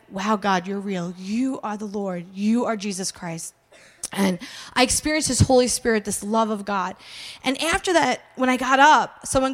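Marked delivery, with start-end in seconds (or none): 0.1-3.5 low, slow, softened reverence; quiet awe. Retold or imitated. Convert addressing God on realising he is real, awed. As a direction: let each declaration settle, low and hushed.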